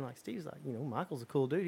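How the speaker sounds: ordinary talk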